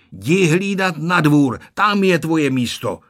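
loud, firm grandfather's command; stern order. A narrator's reading. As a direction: send the dog off in a loud, firm voice. Dědeček vyhání psa na dvůr. (přísný, hlasitý rozkaz)

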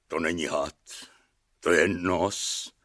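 low, soft, slowing lament; mild sob. A narrator's reading. Castellan telling the king, understated sobbing. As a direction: sink low and quiet, as if tearful. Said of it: "zaštkal kastelán".

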